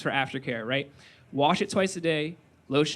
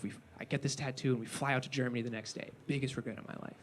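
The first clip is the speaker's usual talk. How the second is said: grandpa's regret; 5 semitones lower, 12 dB quieter than usual. Retold or imitated. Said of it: "he goes".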